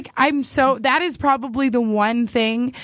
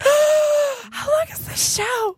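high, slow, excited gasp of a question; eager high-pitched plea to wrap up the show; 14 semitones higher, much slower than usual. Pretend. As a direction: act desperate to leave with him. Gasping, impatient aside.

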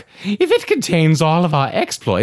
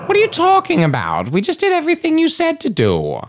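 rushed, pitched-up defensiveness; fast and high; 13 semitones higher, faster than usual. Acted. Defensive reply.

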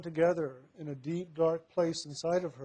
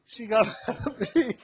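high, louder, breaking into laughter mid-sentence; laughing; 6 semitones higher, 5 dB louder than usual. Real, not acted. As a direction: laugh helplessly through the words. Laughing while telling mother's outburst.

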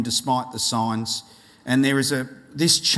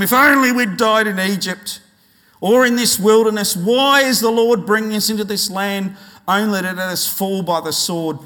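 loud, higher, dramatic grumbling; raised-voice complaint; 5 semitones higher, 7 dB louder than usual. Acted. Israelites grumbling against Moses and Aaron, loud complaint. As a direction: protest loudly like an aggrieved crowd. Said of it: "raised their voices and wept aloud".

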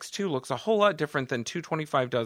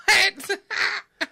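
high squealing laugh; 3 dB louder than usual. Real, not acted. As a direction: burst out squealing. Big laugh.

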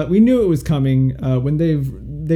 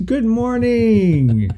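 drawn-out, higher, laughing "Good morning"; radio host greeting; 9 semitones higher, much slower than usual. Pretend. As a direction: stretch it like a cheesy DJ.

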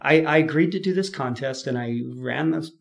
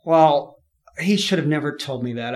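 ordinary talk

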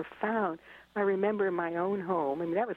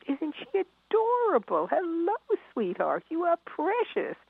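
put-on high sing-song baby talk, much higher; cooing over a baby; 11 semitones higher, 3 dB louder than usual. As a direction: coo at an adorable little one. People cooing over newcomer.

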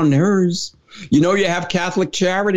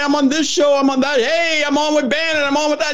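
high, eager rattling-off of his appearances; imitating busy Steve; 10 semitones higher than usual. Host mimicking his friend's constant emails, high and eager. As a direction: play the tireless older activist bragging. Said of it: "he'll be emailing me".

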